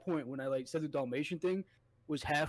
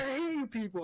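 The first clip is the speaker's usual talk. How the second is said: higher mock outburst of an angry lady; imitating angry lady; 9 semitones higher than usual. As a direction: parody her huffy outburst, pitched up. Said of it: "She's like".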